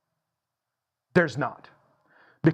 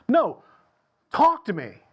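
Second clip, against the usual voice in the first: louder, stern parent order; mock stern parent demand; firm command; 4 semitones higher, 4 dB louder than usual. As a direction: insist the kid speaks up.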